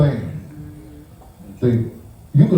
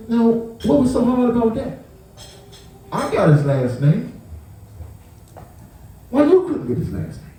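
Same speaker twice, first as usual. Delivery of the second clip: raised, sarcastic reproach; scolding; 6 semitones higher than usual. Retold or imitated. Telling off the receptionist, higher and sarcastic. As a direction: rub it in that it was easy.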